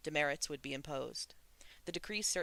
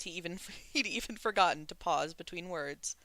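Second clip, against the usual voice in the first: ordinary delivery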